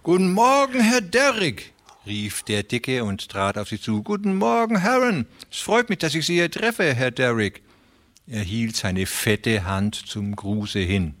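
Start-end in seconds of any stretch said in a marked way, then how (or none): 0.0-1.7 high, loud, oily greeting; fat railway man Beermann. A narrator's reading. Narrator voices fat Beermann. 4.0-7.6 high, fawning voice, quick in the middle; oily and overeager, much higher than the narrator; fat Beermann greeting. A narrator's reading.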